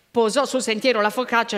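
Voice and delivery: ordinary delivery, nothing marked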